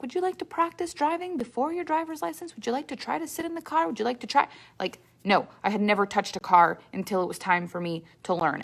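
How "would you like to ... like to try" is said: high, sweet coaxing parent voice; lightly mocking, overly sweet parent offering help; imagined caring parent. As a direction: coax gently like an eager parent. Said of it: "I didn't have a parent that was like".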